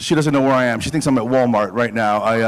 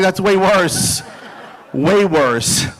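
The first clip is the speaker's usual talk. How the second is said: drawn-out, laughing disbelief; exasperated; 7 semitones higher, much slower than usual. Retold or imitated. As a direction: stretch it out, half laughing. Exasperated reply to company rep.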